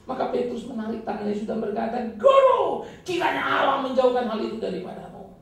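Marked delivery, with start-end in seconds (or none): 2.2-5.4 high, loud pleading shout; Peter's cry. Acted. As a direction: cry out to hold the teacher back. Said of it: "Itu teriakan Petrus".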